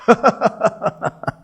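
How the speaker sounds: a short acted laugh for the goddess; a light retold chuckle; imitated laugh. Parvati laughing at Shanichar's worry, a mild acted laugh. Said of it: "Parvati ji laughed".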